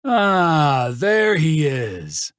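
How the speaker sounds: loud, high, slow booming greeting. A narrator's reading. Trace greeting hungover Axel, booming loud. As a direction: boom it across the kitchen. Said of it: "Trace's voice boomed".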